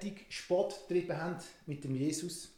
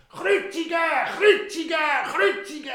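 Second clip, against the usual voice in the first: high, loud furious chant; crowd shouting; 13 semitones higher, 14 dB louder than usual. Acted. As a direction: drown out the governor's plea. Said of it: "alle Leute haben nur noch gerufen".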